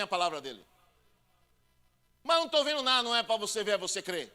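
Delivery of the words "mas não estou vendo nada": high, loud complaining believer; doubting believer. Retold or imitated. Believer complaining to the pastor, high and whiny. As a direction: whine the objection to the pastor.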